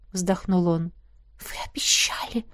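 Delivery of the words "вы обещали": whisper. Acted. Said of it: "прошептала я".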